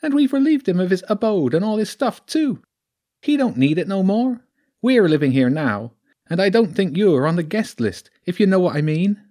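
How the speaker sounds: taunting creature voice, high and loud. Acted. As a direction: gloat as the sly squatter weasel with a Sussex twang. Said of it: "Its voice was high".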